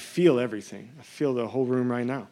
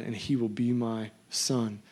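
"son" set apart, slow and weighted; slow right down to land on "son"; stress on SON; slower than usual. Shown as a demonstration. Preacher reading God's promise to the church, slow emphasis on "son". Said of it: "Emphasis on the word son".

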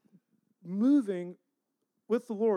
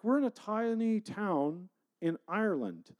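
normal talking voice

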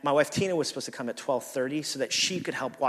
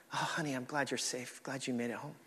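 mock sweet; 11 dB quieter than usual. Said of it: "I didn't do that".

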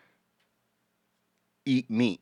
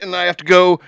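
ordinary talk, nothing marked

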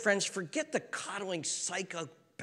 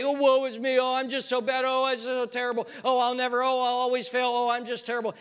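whiny woe-is-me wail, higher and louder; mock self-pity; 7 semitones higher, 4 dB louder than usual. Preacher mocking a self-pitying church with whiny moans. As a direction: ham up the worldly sorrow moaning. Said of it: "didn't just sit there and say".

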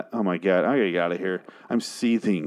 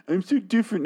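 much higher, squeaky mockery of the singer; extra-high mocking whine; 12 semitones higher, faster than usual. Mocking the rapper's lyric again, far higher and sneering. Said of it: "You say this in the song".

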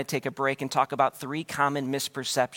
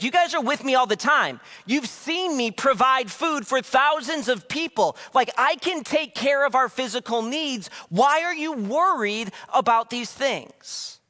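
much higher, louder exasperated pleading, slow at the end; exasperated plea; 8 semitones higher, 3 dB louder, slower than usual. Retold or imitated. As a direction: pour out weary frustration. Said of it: "can you hear Jesus' frustration".

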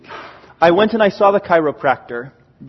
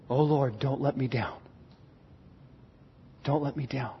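hushed plea; 7 semitones lower, 15 dB quieter than usual. Retold or imitated. Psalmist's plea to God.

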